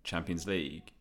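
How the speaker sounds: Champions kept light, League hit as the key word; weight on League, soft and low; stress on League. Shown as a demonstration. Showing the panel his own normal way, stress on League. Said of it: "That's where I put the emphasis".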